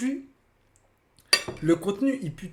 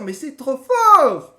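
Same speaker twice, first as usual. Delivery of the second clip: slow, louder, dissolving into laughter; laughing delight; 4 semitones higher, 6 dB louder, much slower than usual. Real, not acted. Streamer delighted by the pun, laughing it off. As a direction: savor it and crack up.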